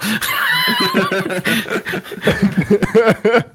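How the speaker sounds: high burst of laughter; nervous laugh. Real, not acted. Laugh after sarcastic thanks.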